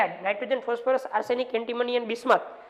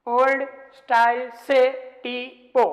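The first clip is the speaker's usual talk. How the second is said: slow mnemonic; 4 dB louder, much slower than usual. Shown as a demonstration.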